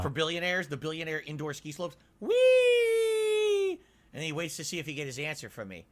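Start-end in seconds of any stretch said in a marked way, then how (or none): 2.2-3.8 long, very high, loud squeal; drawn-out mock "whee". Imitating billionaires skiing down indoor slopes.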